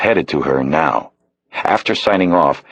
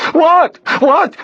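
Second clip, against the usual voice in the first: panicked, very high loud cry; startled cry; 5 dB louder than usual. Acted. Stranger slammed against the door, crying out in panic. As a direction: yelp it out in sudden fright. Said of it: "the man cried out".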